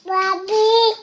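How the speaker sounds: high sustained call, a bit stronger; calling out harder. Real, not acted. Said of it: "और ज़ोर से". (ऊँचे सुर में थोड़ा और ज़ोर से पुकारना)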